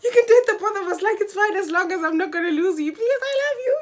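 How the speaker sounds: loud, high, fast sobbing plea; crying loud and fast, begging boyfriend to stay. Retold or imitated. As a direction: wail it out, begging through tears. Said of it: "I was like".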